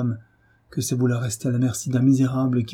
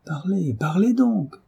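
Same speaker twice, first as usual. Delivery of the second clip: higher, slow insistent urging; urgent demand; 9 semitones higher, slower than usual. Acted. Doctor to the frozen servant, urgent. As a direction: press her to speak.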